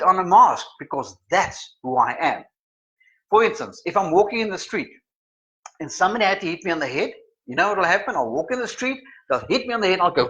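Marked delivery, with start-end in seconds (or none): none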